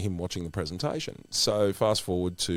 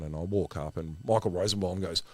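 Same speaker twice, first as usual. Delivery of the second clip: usual speaking voice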